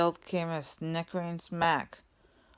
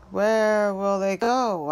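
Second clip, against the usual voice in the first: slow, higher, louder question; Once-ler asking; 4 semitones higher, 7 dB louder, slower than usual. A narrator's reading. Once-ler asking the Lorax, slow and high. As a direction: ask it slowly and high.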